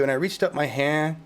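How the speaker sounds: ordinary delivery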